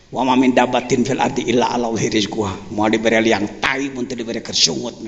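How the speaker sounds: low, monotone sluggard's voice; lazy person. Retold or imitated. Preacher mimicking lazy Indonesians shrugging off work, low lazy drone. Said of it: "Ini orang-orang malas di Indonesia ngomongnya begitu".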